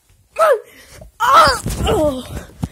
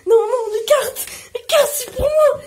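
pleading; 6 semitones higher than usual. Real, not acted. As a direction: beg to keep the cards. Fighting over the cards.

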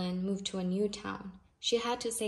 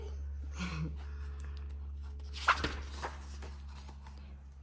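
soft unvoiced chuckle; breathy laugh; 2 semitones lower, 7 dB quieter than usual. Real, not acted. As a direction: laugh quietly to yourself. Laugh after refrain.

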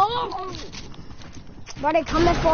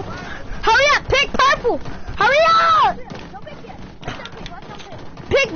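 urgent yelling; 7 semitones higher than usual. Real, not acted. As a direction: shout at them to hurry. Urgently calling team colour.

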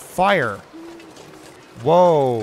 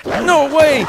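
higher and louder, disbelieving yell; panicked shout; 10 semitones higher, 8 dB louder, slower than usual. Real, not acted. Gamer panicking after his shot, shouting.

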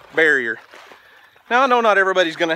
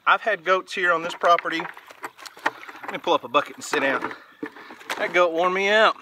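ordinary talk, nothing marked

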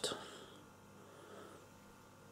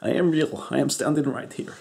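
put-on an offended outburst in the Eastern European accent, louder and higher; indignant; 5 semitones higher, 7 dB louder than usual. Doctor Cough protests to the patient that he is real, indignant and loud. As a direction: bristle at being called not real.

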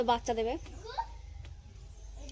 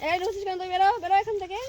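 raised scolding shout; shooing animals away; 6 semitones higher, 4 dB louder than usual. Real, not acted. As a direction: chase them out loudly.

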